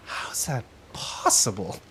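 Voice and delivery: slow, whispered wonder; hushed awe. Acted. Enraptured warriors reacting to the hero, hushed awe. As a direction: breathe it out softly, amazed.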